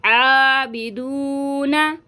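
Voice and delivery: आबिदूना with a long drawn-out दू; lengthened vowel. Shown as a demonstration. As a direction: stretch the vowels of आबिदूना slowly. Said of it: "तीन अलिफ की मिकदार खींचेंगे". (आबिदूना खींचकर)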